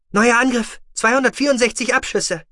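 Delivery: high, loud alarm call; urgent shouted report. Acted. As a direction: bark the casualty report urgently. Battle report over comms.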